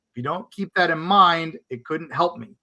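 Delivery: ordinary talk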